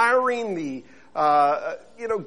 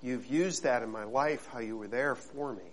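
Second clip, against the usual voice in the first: soft, lower prayerful voice; 6 semitones lower, 10 dB quieter than usual. Acted. Pastor praying softly before his sermon. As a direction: pray quietly and humbly before the congregation.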